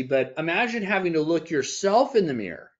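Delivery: usual speaking voice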